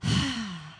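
falling sigh after pain eases; sigh of relief. Shown as a demonstration. Physio patient freed from pain, relieved sigh. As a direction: let the breath drop out, relieved. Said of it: "isn't it like a".

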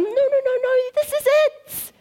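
high-pitched excited protest, last words drawn out; hammed-up squealing refusal; frantic insistence. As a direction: resist like an overexcited child. Acts herself resisting God's call.

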